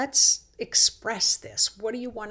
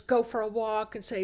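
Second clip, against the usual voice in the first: ordinary delivery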